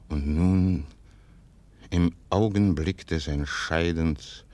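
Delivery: ordinary talk, nothing marked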